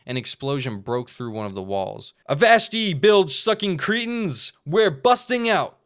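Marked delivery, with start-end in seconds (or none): 2.3-5.8 much higher, loud pirate yell; pirate shout. Acted. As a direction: roar it out like a raider. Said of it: "a voice shouted".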